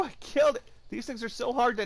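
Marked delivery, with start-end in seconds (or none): 0.1-0.6 higher, louder, caught off guard; dismayed cry. Real, not acted. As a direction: react as if it just went wrong. Accidentally kills tame target.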